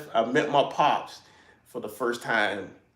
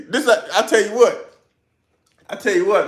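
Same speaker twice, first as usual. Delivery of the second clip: fired up; 8 semitones higher, 8 dB louder than usual. Real, not acted. Defending Clemson to the comments.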